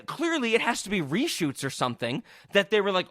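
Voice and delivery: ordinary delivery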